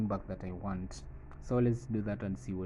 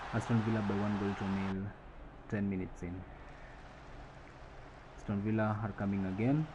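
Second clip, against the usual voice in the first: ordinary delivery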